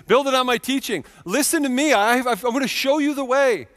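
lightly voiced teacher calling people to listen; Jesus teaching. Voices Jesus's urgent answer.

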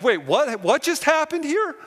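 very high, loud, slowed bewildered cry; stunned confusion. Retold or imitated. Son at his mother's bedside, very high stunned bewilderment. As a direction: relive the shock of losing mom. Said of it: "And I'm like".

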